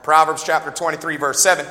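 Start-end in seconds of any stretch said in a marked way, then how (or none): none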